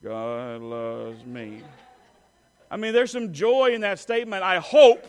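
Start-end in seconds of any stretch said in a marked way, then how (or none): 0.0-1.6 low, slow and joyless; mock glum. Shown as a demonstration. Preacher mocking a joyless church reply, flat and mopey. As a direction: drag it out with no joy at all. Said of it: "you don't have to say it like".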